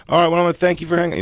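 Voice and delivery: high, fast DJ sign-off; chirpy host rattling it off; radio DJ voice. Retold or imitated. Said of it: "he goes".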